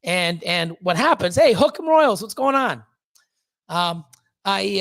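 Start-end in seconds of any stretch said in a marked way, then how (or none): 1.4-2.8 higher, louder cheery call-out; mock hearty greeting. Greeting a fan by nickname, loud cheery hail. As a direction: hail the buddy across the room, loud and bright.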